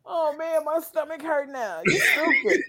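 stomach hurts from laughing; high giggly protest. Real, not acted. Laughing at the joke.

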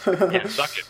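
laughing. Real, not acted. Gloating over rival show losing.

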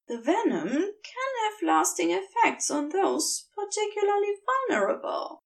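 high, earnest angel voice; Aziraphale's voice. A narrator's reading. The angel tells the demon he is different, high and earnest. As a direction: lift the voice high and keep it gentle.

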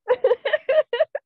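squealing giggle, high and loud; high laugh. Real, not acted. After calling him a Doctor Strange wannabe, a high squealing laugh. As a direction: burst out laughing at your own joke.

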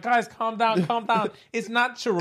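high, softened voice; imitated teacher settling the class; pacifying teacher. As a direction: gently plead for calm. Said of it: "My teacher's like".

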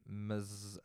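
ordinary talk, nothing marked

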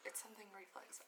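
unsure, whispered to herself. Real, not acted. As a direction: whisper it, searching for the word. Trails off, unsure of the word.

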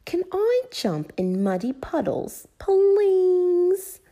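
put-on pleading little girl, climbing to a long high please; Peppa. A narrator's reading. Peppa asking Granny for puddles, pleading. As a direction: beg with a drawn-out last word. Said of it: "She really wanted to jump".

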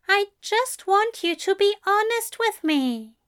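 high-pitched earnest plea; pleading, high angel voice. Acted.

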